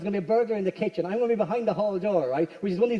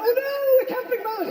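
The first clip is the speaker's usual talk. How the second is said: mock fear; 13 semitones higher than usual. Mock terrified burglar.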